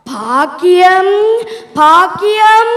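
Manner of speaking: loud, drawn-out name call; shouting. Acted. Husband yelling for his wife at home, loud and drawn-out. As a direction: holler for someone, stretching the name. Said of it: "ஏனப்பா கத்துறிய?".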